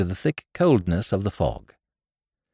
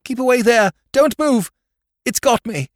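high, loud panicked shout; sharp shout; 13 semitones higher, 5 dB louder than usual. Acted. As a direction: shout a warning in terror. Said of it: "A sharp shout in the utter blackness".

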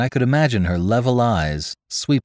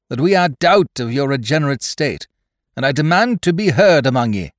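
defiant sailor demand; 7 semitones higher, 4 dB louder than usual. A narrator's reading. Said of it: "The gunner's whine rose, but with an ugly note".

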